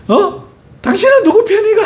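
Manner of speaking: wife snapping at her husband for taking sides; indignant wife. Retold or imitated. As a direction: fire it back at him, hurt and indignant.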